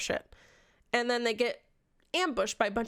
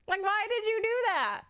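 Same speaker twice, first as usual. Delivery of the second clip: why did you do that, much higher and incredulous; mock disbelief, high; 10 semitones higher than usual. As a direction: squeak it in disbelief. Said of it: "repeated much higher".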